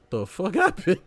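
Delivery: quoting through a laugh; amused retelling of the line; laughing quote. Retold or imitated. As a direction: laugh while saying it. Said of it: "He said".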